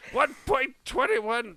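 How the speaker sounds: high, slow movie-quote impression; Christopher Lloyd. Podcaster quotes the actor's famous line, high impression. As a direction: draw each word out, pitched high. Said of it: "I don't do a Christopher Lloyd impression".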